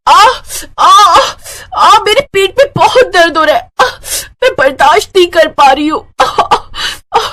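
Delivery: high acted cries of labour pain. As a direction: cry out in pain between words. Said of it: "वो बहुत तेज-तेज चीखने लगी". (दर्द से ऊँची चीखें)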